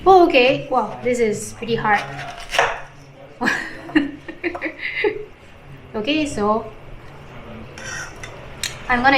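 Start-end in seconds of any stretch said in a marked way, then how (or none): 3.4-5.2 breathy giggle. Real, not acted. Struggling with the hard dessert.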